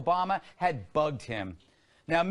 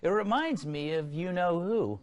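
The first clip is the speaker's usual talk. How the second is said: ordinary talk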